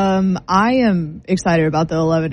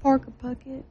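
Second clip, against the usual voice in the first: the name said in a posh English accent, higher and softer; 5 semitones higher, 8 dB quieter than usual. Shown as a demonstration. Friends on air testing the name in a soft English accent. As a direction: try the name in a light English accent to make it sound classy. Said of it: "That was, like, an English accent".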